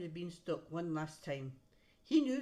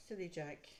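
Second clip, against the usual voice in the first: ordinary delivery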